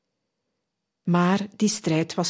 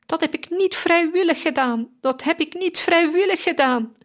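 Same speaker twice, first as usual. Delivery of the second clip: high, desperate shriek of a dying man; high anguished scream, repeated; 10 semitones higher than usual. A narrator's reading. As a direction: cry it out much higher, as if tormented. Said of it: "en gilde".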